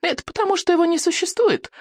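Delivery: put-on very high, piping boy voice; high sing-out of stubborn contradiction; stubborn boy insisting. A narrator's reading. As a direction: insist in a high childish pipe. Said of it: "гнул своё Икинг".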